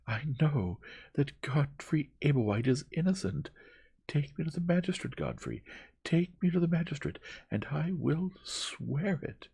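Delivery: high, loud, impassioned demand; enraged. A narrator's reading. Young woman demanding to face the magistrate, enraged. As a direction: insist furiously, stressing each phrase. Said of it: "in the rage that possessed her".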